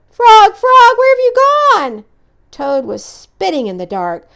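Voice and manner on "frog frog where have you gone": very high, loud desperate call; calling out in panic; desperate loud cries; frantic calling. Acted. Toad frantic searching for frog.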